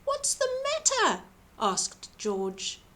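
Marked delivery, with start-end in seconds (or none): 0.0-1.2 high, gentle caring question; concerned giant. A narrator's reading. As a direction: ask kindly, voice lifted high. George asks with concern.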